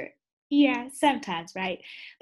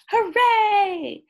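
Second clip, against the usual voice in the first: high, loud cheering hooray; 11 semitones higher, 8 dB louder than usual. A narrator's reading. Cats cheer that it's milk time, high and loud. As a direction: cheer like the whole class.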